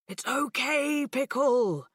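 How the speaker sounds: lightly voiced, warm dad; bright and warm. A narrator's reading. Dad comforting his upset daughter, bright and warm. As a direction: reassure her gently. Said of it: "in his bright and warm voice".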